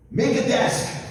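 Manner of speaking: acted eager instruction; confident suggestion. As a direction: put it to him decisively. Wife proposes plan to husband.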